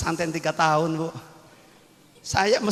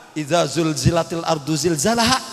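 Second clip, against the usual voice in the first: Zainab reciting; 2 semitones lower than usual. Retold or imitated. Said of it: "kata Zainab".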